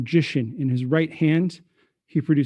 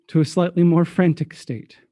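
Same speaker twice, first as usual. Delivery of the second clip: normal talking voice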